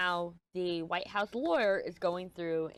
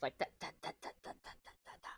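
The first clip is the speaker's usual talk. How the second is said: whispered da da da; 11 dB quieter, much faster than usual. Shown as a demonstration. Mimicking jumping topics.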